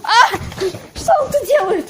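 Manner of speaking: startled high scream of fright; frightened scream. Real, not acted. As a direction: yelp in sudden terror. Jump scare in horror game.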